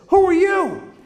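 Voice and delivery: mock bratty challenge; sassy child. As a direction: toss it out like a brat. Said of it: "very disrespectful".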